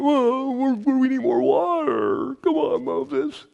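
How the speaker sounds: high, slow whiny complaint; mock the grumbling crowd; whining people. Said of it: "they're complaining again".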